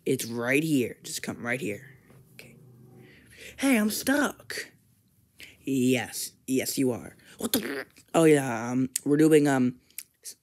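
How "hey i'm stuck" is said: high, slow, stretched-out complaint; a high drawn-out whine at being stuck; whiny, high and slow; high stuck cry. Acted. Teddy stuck in helmet.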